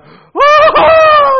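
high-pitched panicked scream; reenacted freak-out scream; yelling. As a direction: let out a huge, high yell. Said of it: "Now, I'm yelling".

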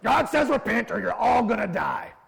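high, loud, harsh prophet's shout of doom; Jonah shouting. Retold or imitated. Jonah shouts his message at the Ninevites, harsh and loud. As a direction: bark the doom like a loveless prophet. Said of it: "no love in his heart for the Ninevites".

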